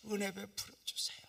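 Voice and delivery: breathy whispered prayer on 은혜; whispered plea. Retold or imitated. Pastor whispering his plea to God. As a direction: whisper 은혜 베풀어 주세요 as a plea.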